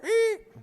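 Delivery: very high squeak; a quick cartoon eek.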